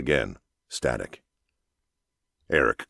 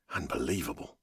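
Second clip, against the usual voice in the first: much softer, almost whispered; near whisper; 11 dB quieter than usual. Acted. Pilot reading the impossible temperature, in a near whisper. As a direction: breathe it out in disbelief. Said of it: "he said in a near whisper".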